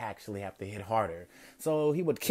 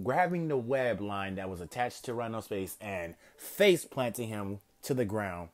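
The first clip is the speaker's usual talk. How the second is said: ordinary delivery, nothing marked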